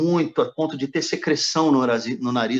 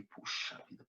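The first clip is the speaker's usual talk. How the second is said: whispered sigh; 18 dB quieter than usual. Retold or imitated. His reaction to the command.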